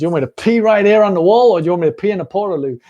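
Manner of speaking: fast, loud, badly faked American accent; poor American accent. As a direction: imitate an American shouting at officials. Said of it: "That was my poor American accent".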